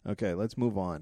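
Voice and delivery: normal talking voice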